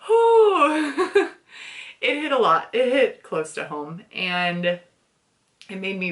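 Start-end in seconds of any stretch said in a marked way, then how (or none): 0.0-1.3 high, loud shaky exhale through a laugh; teary whew. Real, not acted. Reviewer fighting tears over the book, high laughing whew. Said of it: "I'm getting teary just thinking about it".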